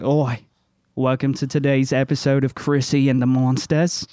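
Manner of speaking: hammed-up, joke Australian accent; bad Australian accent. Monster hunter greets his viewers on camera, bad Australian accent. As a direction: show host putting on a poor Australian accent. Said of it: "don't do a really good Australian accent".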